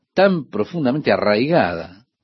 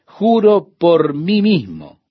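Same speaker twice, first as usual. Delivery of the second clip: slow, raised, weighty oath; solemn divine declaration; 6 semitones higher, 4 dB louder, slower than usual. Acted. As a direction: declare it slowly and emphatically, each word standing out. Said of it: "les declara Dios".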